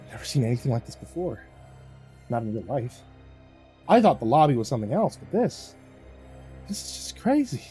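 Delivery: softly gasped amazement; hushed, astonished disbelief; awed gasp. A narrator's reading. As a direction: gawk like a child, quietly. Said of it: "and I gasped".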